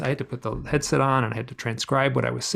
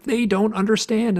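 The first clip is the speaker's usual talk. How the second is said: higher, louder anguish; pained higher cry; upset outcry; 8 semitones higher, 4 dB louder than usual. Retold or imitated. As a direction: blurt it out in distress. Retold emotional outburst.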